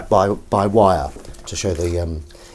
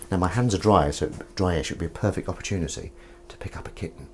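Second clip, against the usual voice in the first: ordinary talk, nothing marked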